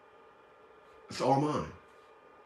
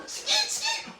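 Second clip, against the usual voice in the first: high, soft squeaky skeet calls; playful falsetto skeet noises; mock skeet squeal; 7 dB quieter than usual. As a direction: squeak it out like a silly sound effect.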